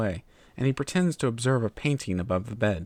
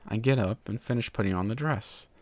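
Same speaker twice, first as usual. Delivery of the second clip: ordinary delivery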